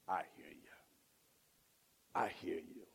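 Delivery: low, soft, reassuring; tender God voice. Acted. God to the sinner, low and tender. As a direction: speak like a gentle father. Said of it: "God says".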